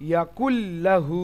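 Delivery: slow "ইয়াকুল্লাহু" with a doubled l; merged recitation. Shown as a demonstration. Teacher reciting an example to understand well, merged l. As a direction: hold the l and lengthen the final u of "ইয়াকুল্লাহু". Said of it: "এটা ভালো করে বুঝতে পারবেন".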